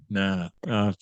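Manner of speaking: normal talking voice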